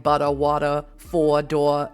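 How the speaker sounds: dropped final R's, Boston style; non-rhotic Boston demo; Boston accent. Shown as a demonstration. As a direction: drop the R off butter, water and door. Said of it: "anything that has an R at the end".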